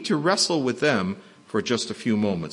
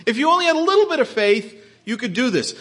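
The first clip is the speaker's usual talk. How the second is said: chastising; 7 semitones higher, faster than usual. Pretend. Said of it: "as if he's chastising them".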